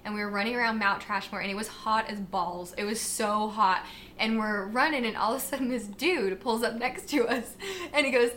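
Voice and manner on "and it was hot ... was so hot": ordinary talk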